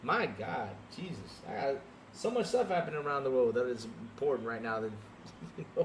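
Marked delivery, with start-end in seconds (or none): none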